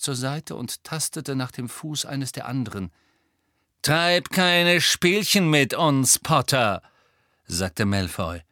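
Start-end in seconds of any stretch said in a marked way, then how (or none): 3.8-6.8 slow, raised, loud warning; threatening villain. A narrator's reading. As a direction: draw out the threat to a stalling opponent. Villain warns the hero.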